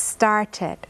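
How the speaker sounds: started with the ed said as a clear extra syllable "id". Shown as a demonstration. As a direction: let started end in a full extra syllable. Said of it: "พอใส่ ed เข้าไปก็จะเป็นเพิ่มอีกหนึ่งพยางค์". (ออกเสียง started เพิ่มพยางค์ "อิด" ชัดเจน)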